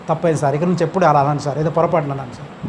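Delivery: low, hurried mock apology; retold apology. Watchman at the preacher's feet asking forgiveness, mildly apologetic imitation.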